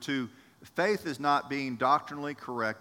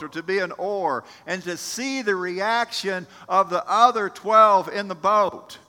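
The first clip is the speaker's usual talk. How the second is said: impassioned preaching; 6 semitones higher, 8 dB louder than usual. Real, not acted. Preacher building to full force.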